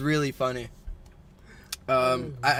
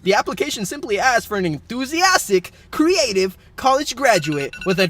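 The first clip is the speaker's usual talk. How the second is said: louder, upbeat newscaster delivery; mock news read; 4 semitones higher, 7 dB louder than usual. Host reads silly job news to co-host, mock newscaster. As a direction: hype it like a news anchor racing a timer.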